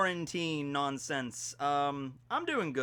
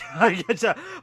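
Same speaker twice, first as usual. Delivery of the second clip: loud, high burst of laughter; a quick real laugh; short laugh; 6 semitones higher, 7 dB louder than usual. Real, not acted. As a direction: laugh at your own joke. Laugh after at least not yet.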